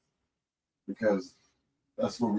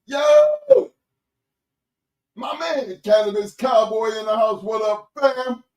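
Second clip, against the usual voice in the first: high, loud DJ shout-out voice; hype announcer; 11 semitones higher, 6 dB louder, slower than usual. Acted. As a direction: call out each name like a radio DJ.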